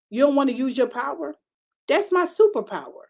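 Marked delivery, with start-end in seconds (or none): none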